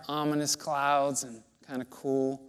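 normal talking voice

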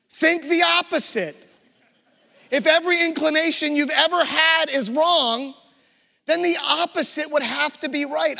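ringing pulpit declaration; preached emphasis. Acted. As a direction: preach it out to the whole room. Preacher drives the point home.

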